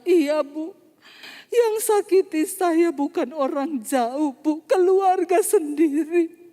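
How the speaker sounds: high, drawn-out mock weeping; slow, loud, imitated crying voice; imitated tearful wail. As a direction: act out a woman wailing out her hurt, slowly. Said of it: "Tiba-tiba dia mulai menangis".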